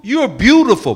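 high, loud swooping flattery; imitating strong men. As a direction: croon it loudly as a luring creature. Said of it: "their voice sounded like grown, strong men".

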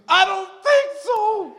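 high, slow, falsetto "I don't think so"; bride's reply. Retold or imitated. Preacher voicing the bride at the altar, high and slow. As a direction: draw it out high as the hesitant bride. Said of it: "She looked at him".

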